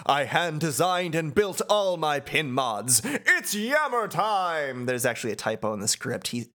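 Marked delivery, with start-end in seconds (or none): 0.1-4.9 high, boastful character voice; hammed-up proud boast; Yammer. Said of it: "Yammer says".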